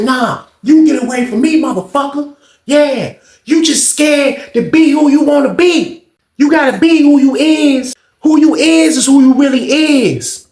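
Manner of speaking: high, slow taunting rant; retold taunting. The boy calling Sean out, high taunting yell.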